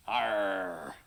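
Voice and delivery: low pirate Arr. Shown as a demonstration. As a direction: growl it like a pirate. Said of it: "when I played a pirate".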